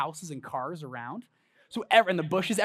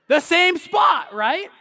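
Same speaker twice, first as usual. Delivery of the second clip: excited shout; 12 semitones higher, 11 dB louder, much slower than usual. Real, not acted. Calling out answer to audience.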